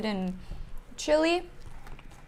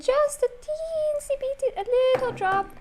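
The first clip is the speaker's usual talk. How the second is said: cutesy high voice. Real, not acted. Cutesy tiny portion description.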